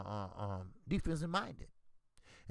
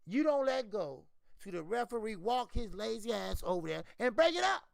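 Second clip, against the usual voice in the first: ordinary delivery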